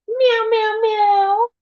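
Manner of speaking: high mock cat meows. Owner meows at her cat Luna, high mock meowing. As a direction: meow up high like a kitten.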